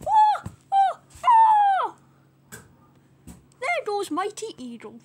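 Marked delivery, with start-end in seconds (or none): 0.1-2.0 loud, very high cartoon yelps; high acted cries. Bird under attack, yelping high and loud. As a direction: yelp high and loud, last one longest.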